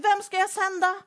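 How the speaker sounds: high, loud calling question; God calling out. Acted. God calling for someone to send, loud and high. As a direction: call it out to the crowd. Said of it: "Då frågar Gud".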